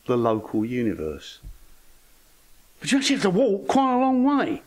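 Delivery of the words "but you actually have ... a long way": comic high-pitched protest at the distance; high-pitched exclamation. Real, not acted. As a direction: voice shoots way up, emphatic. Comic exclamation about the walking distance.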